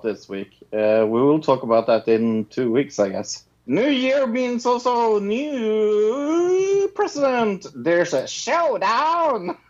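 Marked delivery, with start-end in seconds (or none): 3.7-9.6 slow, high, hammed-up American announcer; fake American accent. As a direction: drawl it like a movie trailer American. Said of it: "my fake American accent".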